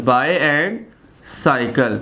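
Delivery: BUY, pause, then CYCLE; two parts. Shown as a demonstration. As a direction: split bicycle into buy and cycle. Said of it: "तो टू syllable".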